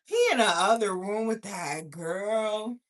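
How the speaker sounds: stretched-out indignant repeat; incredulous echo. Retold or imitated. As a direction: throw the words back as an outraged question. Repeats his words back in disbelief.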